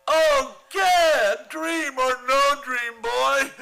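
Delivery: a jolt of fear at the hatchet; startled fear. Acted. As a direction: blurt it out in a fright. Conklin frightened by hatchet.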